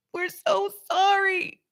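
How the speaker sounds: very high, crying voice of sorry crystals; crystals character. Acted. Improv crystals pleading with the woman, crying high. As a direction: wail the apology as tiny weeping crystals. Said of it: "I totally went into character".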